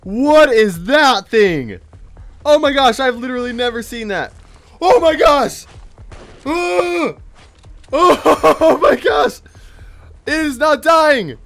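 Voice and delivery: high, loud, stretched-out shock; alarmed shouting. Real, not acted. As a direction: cry out in panic at a monster. Unknown monster will not die.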